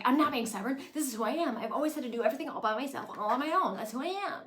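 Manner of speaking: loud, high, indignant protest; defiant outburst. Retold or imitated. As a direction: stand her ground, shouting. Lorelai defends herself to Emily.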